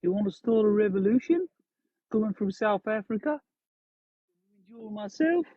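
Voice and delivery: high-pitched mock South African; South African accent attempt. Shown as a demonstration. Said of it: "my South African accent is".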